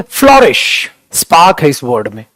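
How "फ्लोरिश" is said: higher, energetic "flourish"; with a spark. Shown as a demonstration. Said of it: "spark कैसे आती है?". ("Flourish" ऊँचे सुर में, जोश से)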